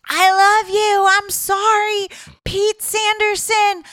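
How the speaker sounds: high, loud calling-out across a stadium; retold yell.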